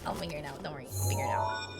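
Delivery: low, soft, half-whispered; muttering to self. Real, not acted. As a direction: mutter it under your breath.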